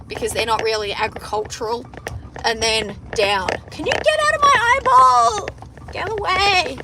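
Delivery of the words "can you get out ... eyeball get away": high-pitched protest; high, irritated cry; real exasperated outburst. Real, not acted. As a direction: shoo it away, voice leaping up. Snapping at something in her eyes.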